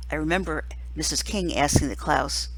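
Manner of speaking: usual speaking voice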